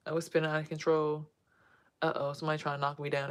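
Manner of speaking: ordinary delivery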